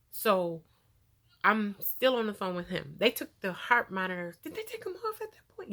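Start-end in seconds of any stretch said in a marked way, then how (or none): 4.4-5.7 aside. Real, not acted. Asking herself mid-story.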